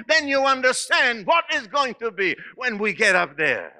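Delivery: soaring preacher pitch; preaching. Acted. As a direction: lift the congregation up, high and eager. Preaching at full force.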